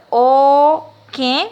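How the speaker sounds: "O qué" with a nasal final vowel, drawn out; nasal accent. Shown as a demonstration. Teacher dictating a word to pupils, "O qué" with nasal E. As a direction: stretch "O qué" loudly, nasalising the E. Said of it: "pero con acento nasal".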